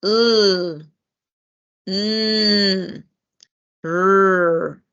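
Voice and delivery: L, mm, r held with the voice buzzing; teacher modelling voiced sounds; voiced consonants. Shown as a demonstration. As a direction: hum each sound to feel vibration. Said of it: "so you can see that the vocal cords vibrate".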